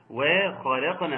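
وخلقنا with a thin, light waw. Shown as a demonstration. Teacher shows the light waw in وخلقنا. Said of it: "ترقيق الواو".

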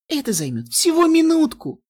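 high eager squeal; excited. Acted. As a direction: burst out with giddy eagerness. Eager inner pony voice bursts out.

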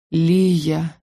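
drawn-out, aspirated murmur; breathy tenderness. A narrator's reading. Dean kissing her fingers, breathy and tender. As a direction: sigh the name adoringly. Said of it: "проговорил снова с придыханием".

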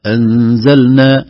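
slow, loud recitation stopping on إنا; recited pause. Shown as a demonstration. Teacher showing a stop while reciting. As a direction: chant إنا, hold it, then أنزلنا. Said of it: "إذا وقفنا".

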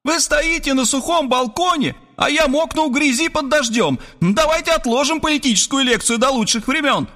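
high, sharp woman's voice; soaked woman scolding Robert on the dry balcony; sarcastic rebuke. Acted. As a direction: put him in his place.

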